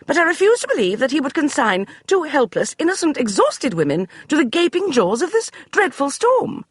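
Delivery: high, theatrical plea; dramatic. Acted. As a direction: lay it on thick. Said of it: "rather dramatic turn of phrase".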